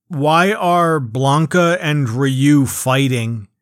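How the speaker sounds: usual speaking voice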